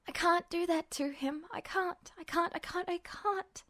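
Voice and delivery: breaking, hushed and pleading; Ed begging, close to tears; desperate pleading. Acted. Ed pleads with Justin not to hurt Roy, broken. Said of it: "screaming at him in panic-laced anguish".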